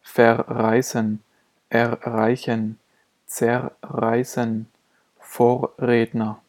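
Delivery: slowly, vowel R then consonant R in "Verreißen", "erreichen", "zerreißen"; both Rs sounded. Shown as a demonstration. Teacher showing two Rs in a row, slow, both pronounced. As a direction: separate the two Rs in "Vorredner", vocalic first, consonantal second. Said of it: "werden beide nacheinander gesprochen".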